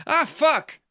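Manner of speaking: high, loud exasperated outburst; real annoyed realisation, high; sudden dismay. Real, not acted. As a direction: caught out by the date, blurting high. Sudden realisation about the year.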